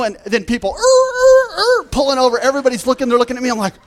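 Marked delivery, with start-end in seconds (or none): 0.7-1.8 high, loud imitated tire screech; brake squeal. Speaker mimicking drivers braking hard to pull over, a high loud screech. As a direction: screech like cars skidding to a stop.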